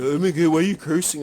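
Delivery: a hammed-up pearl-clutching complaint; mock scandalized whine. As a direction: parody the prissy complainer. Mocking complainers in chat.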